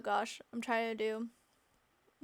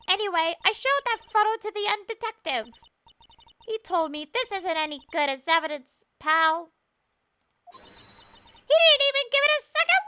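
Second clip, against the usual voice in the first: put-on high, cranky old crone; weird witch voice; 8 semitones higher, 10 dB louder than usual. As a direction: scold like a nosy old hag. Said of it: "sounding like a weird witch in my head".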